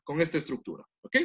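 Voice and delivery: ordinary talk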